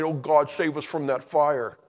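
mildly urgent mock prayer; pleading prayer. Believers praying to be saved from fire, pleading. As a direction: plead a little, as if praying for rescue. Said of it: "we're hoping and praying".

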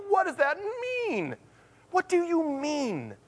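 high-pitched puzzled plea; baffled questioning. Acted. Believer asking what his word means, high baffled plea. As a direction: push it up high, stretching the last question.

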